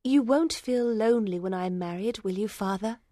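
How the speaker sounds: mildly anxious. A narrator's reading. Daughter asks father about loneliness, mildly anxious. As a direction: ask with a hint of anxiety. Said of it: "Lucy asked anxiously".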